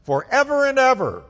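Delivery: high, loud proclamation; ringing shout of praise. Acted. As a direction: let the praise ring out at full voice. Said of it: "their voices rang out".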